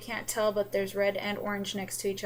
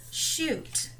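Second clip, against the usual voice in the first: under-breath curse; 7 semitones higher than usual. Real, not acted. Mutters at own numbering mistake.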